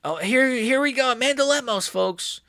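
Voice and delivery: high-pitched excited introduction; mock hype announcement. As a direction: announce the fighter high and giddy. Mock hype for fighter's entrance.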